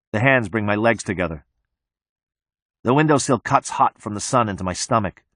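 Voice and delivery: ordinary delivery, nothing marked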